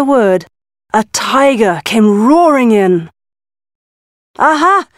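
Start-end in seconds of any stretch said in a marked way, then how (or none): 4.4-4.9 aha. Acted. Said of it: "it said".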